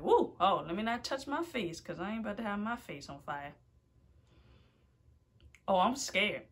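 ordinary delivery

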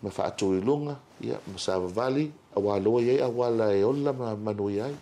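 ordinary talk, nothing marked

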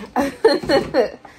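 a short, loud guilty laugh. Real, not acted. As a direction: laugh it off sheepishly. Laughs at own confession.

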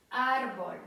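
Árbol with a forceful first syllable; penultimate stress. Shown as a demonstration. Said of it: "Akcent pada na przedostatnią sylabę".

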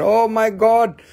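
mock amazed collector; excited discoverer. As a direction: gush like someone finding treasure. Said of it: "somebody came and they said".

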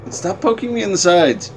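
high, loud complaint; exasperated, tell them off; annoyed game unit. Real, not acted. Scolding a game companion.

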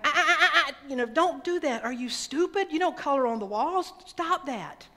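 high, loud scolding with a nervous laugh; angry father scold. Retold or imitated. Father yelling at his son for coloring on the wall, angry. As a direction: snap at the boy high and loud.

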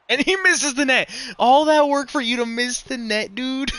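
loud, high yell at the miss; frustrated yell. Real, not acted. Gamer yelling at his AI teammate for missing the net, loud frustrated yell. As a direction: shout at the screen in frustration.

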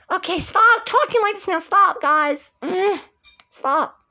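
high-pitched acted protest; exasperated plea. Begging the guys to stop arguing, high exasperated voice.

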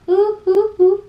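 high, loud "ooh" hoots; playful high-pitched ooh calls; echo-test hoots. Shown as a demonstration. Testing the room for echo.

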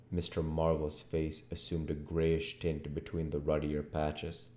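usual speaking voice

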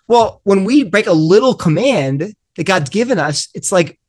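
normal talking voice

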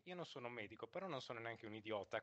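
ordinary talk

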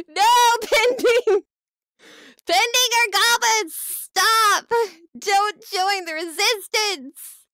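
high, loud mock cry of betrayal; hammed-up anguished yell. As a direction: wail the last line slow and drawn out. Viewer Pending defects again.

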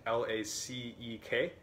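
"L A C E K" slowly, letter by letter; slow, separate letters in "L A C E K"; spelled out. Shown as a demonstration. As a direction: spell out "L A C E K" one letter at a time. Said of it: "but it's spelled".

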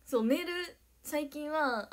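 normal talking voice

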